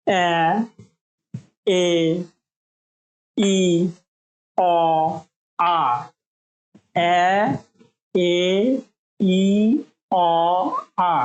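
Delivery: each vowel এ ই অ আ cut off short; short vowel sounds. Shown as a demonstration. Teacher demonstrating short vowels one by one. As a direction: clip every vowel quickly. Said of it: "short sound এগুলো".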